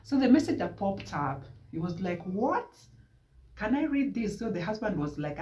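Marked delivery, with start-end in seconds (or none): 2.2-4.4 high, startled disbelief; shocked question. Retold or imitated. Man seeing the message, startled and high. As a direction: recoil at what he just read.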